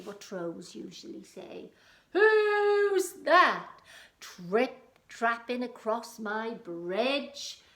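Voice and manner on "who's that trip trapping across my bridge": high, loud, very slow troll roar. Acted. Troll challenging whoever crosses his bridge, roaring. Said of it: "he roared".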